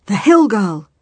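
high, loud outburst; shocked exclamation. A narrator's reading. Trader recognises the farmer's daughter, loudly shocked. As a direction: blurt it in loud astonishment. Villain recognises the disguised girl.

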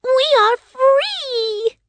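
very high cheer, long drawn out; joyful Winkie cry. A narrator's reading. Freed slaves celebrating, high joyful cry. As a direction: shout for freedom, stretching the words. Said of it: "they cried".

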